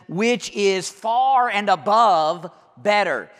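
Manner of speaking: slow, swelling delivery of "far and above"; stress on far. Shown as a demonstration. Preacher driving home Paul's far better. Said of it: "he goes on in verse twenty-three".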